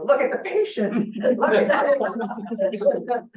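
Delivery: a mother pressing the doctor, half laughing; mother's urgent plea. Retold or imitated. Mother voiced urging doctor.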